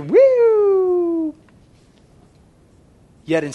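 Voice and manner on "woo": high rising siren woo. Retold or imitated. Remembering kids copying the siren sound. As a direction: howl like the siren. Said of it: "And then you're".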